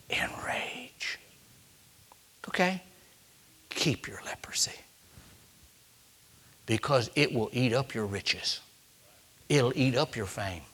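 soft, unvoiced near-whisper; hushed warning. Real, not acted. Preacher warns the congregation in a whisper. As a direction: drop to a whisper to warn them.

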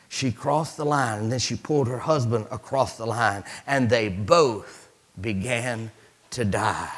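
ordinary talk, nothing marked

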